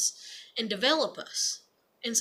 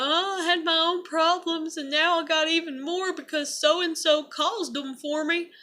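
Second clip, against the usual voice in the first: whiny put-on lament, pitched up; mock crying complaint; 6 semitones higher than usual. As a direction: imitate someone wailing about their problems. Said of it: "they come crying, you know".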